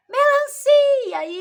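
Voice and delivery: put-on very high, loud, stretched children's answer; class chorus. Class answering the fruit riddle together, high loud drawn-out chorus. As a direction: shout it out slowly like a whole class. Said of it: "Todo mundo".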